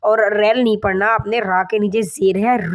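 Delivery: normal talking voice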